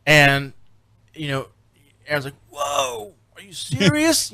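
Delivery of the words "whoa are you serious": high, drawn-out whoa of disbelief; squeaky-high mock shock; mock astonishment. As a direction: imitate a stunned friend, pitch soaring. Acting out stunned crewmate's reaction.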